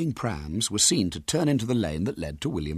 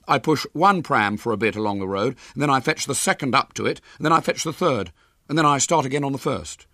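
earnest schoolboy, lightly raised; boy voice; 6 semitones higher, 3 dB louder than usual. Acted. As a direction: explain it like a bluffing boy. William explains the prams.

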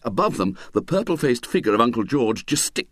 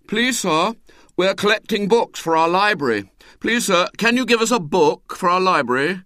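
higher, polite schoolboy asking; polite boy; 7 semitones higher, 4 dB louder than usual. Acted. Boy asking sternly for a book, polite high voice. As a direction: sweetly ask for a book.

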